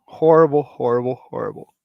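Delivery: horrible three times, first loud then dying away; fading horrible. Real, not acted. Groaning to viewers about burpees, fading repeats.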